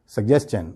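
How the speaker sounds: "سجیشن" in one go as sug-jes-chun; don't pause inside "سجیشن", end on CH; without stopping. Shown as a demonstration. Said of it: "رکنا بھی نہیں ہے". ("سجیشن" بغیر رکے "سگجیسچن")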